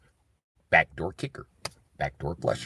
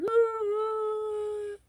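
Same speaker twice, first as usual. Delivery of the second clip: mock crying wail; 4 dB quieter than usual. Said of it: "Ron gonna be down there crying like".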